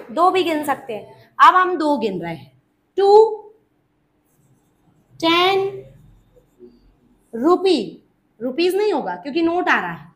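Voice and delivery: ordinary talk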